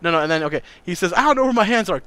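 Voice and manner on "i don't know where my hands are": quicker high-pitched panic; retold high cry of alarm; panicked cry. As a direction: imitate a freaked-out kid. Kid panicking about his hands.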